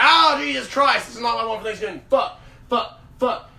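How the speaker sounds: high, loud cry of disbelief; acted-out appalled reaction; dismayed outburst. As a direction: blurt it out in horror, then punch out each curse. Acting out finding the dildo.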